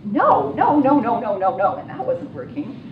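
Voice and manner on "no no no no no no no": loud insistent no, no, no; firm repeated no. Retold or imitated. Yelling no at a man beating a woman. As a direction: shout no at the attacker to make him stop. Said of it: "got all Canadian and went".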